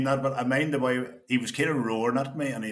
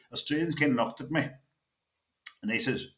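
ordinary talk, nothing marked

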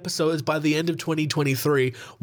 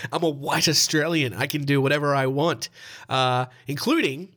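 usual speaking voice

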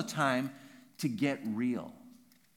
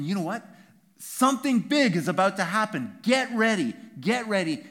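urgent proclamation; 6 semitones higher, 9 dB louder than usual. Acted. Preacher voicing John's call.

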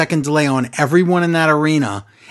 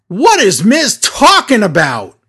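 high, put-on voice of a clueless commentator; mocking Tom; 4 semitones higher, 5 dB louder than usual. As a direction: play the dumb announcer, slow and baffled. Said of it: "Idiot Tom says".

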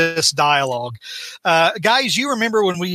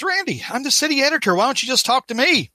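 higher-pitched acted plea; exasperated appeal; 7 semitones higher than usual. City editor appeals to protesting Randy, acted exasperated plea. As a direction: call out to him, pleading to be heard. Father calls to protesting son.

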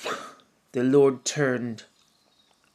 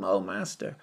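Judas, a touch of fake tenderness; sweetly false greeting, hinting betrayal; mock affection; 6 semitones higher, 5 dB quieter than usual. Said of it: "with a mock show of affection".

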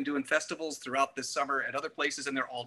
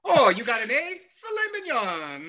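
higher, slow mock-impressed parent; delighted parent; 10 semitones higher, 4 dB louder than usual. Parent rewarding a son's A grade, high slow mock delight. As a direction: gush over the kid's A, drawn out.

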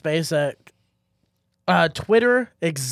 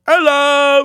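loud, high hammed-up South African hello; South African accent; 10 semitones higher, 9 dB louder than usual. Shown as a demonstration. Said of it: "this is the South African accent".

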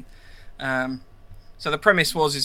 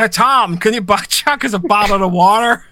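louder, higher Belfast accent, "bottle of water" thick and slurred; 5 semitones higher, 6 dB louder than usual. Shown as a demonstration. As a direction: give the water request in a broad Belfast accent. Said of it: "You need to give it a go".

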